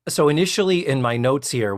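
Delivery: ordinary talk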